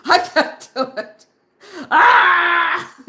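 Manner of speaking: half-laughing, half-sobbing panic that builds to a loud wail; hammed-up breakdown over money, rising into a cry; panicked crying. Retelling her fear of quitting nursing, a mock sobbing panic. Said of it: "and I go".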